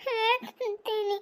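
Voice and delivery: sobbing out words in pieces; high, slow wail broken by sobs; crying child. Acted. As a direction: threaten to tell on them while bawling. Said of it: "ಅಳಬಾರ್ದವ".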